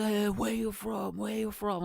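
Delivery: high, slow mock challenge; imitated heckling local. Pub local questioning passing foreigners, imitated heckling. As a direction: call after strangers in a put-on voice.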